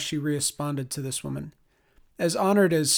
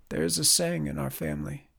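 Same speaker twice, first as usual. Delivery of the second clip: hissed; 4 dB quieter than usual. Acted. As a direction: hiss the words through anger. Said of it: "Ingfrid hissed".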